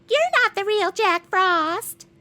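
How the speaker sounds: put-on high, slow whiny child voice; snotty kid. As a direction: sneer it like a bratty kid. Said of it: "kids getting all snotty".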